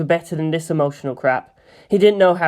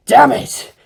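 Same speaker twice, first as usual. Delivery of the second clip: loud, higher angry outburst; frustrated shout; 9 semitones higher, 7 dB louder than usual. Acted. Humiliated boy shouts his frustration aloud. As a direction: spit it out in frustration.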